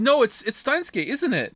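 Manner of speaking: ordinary delivery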